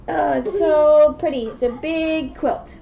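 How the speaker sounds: normal talking voice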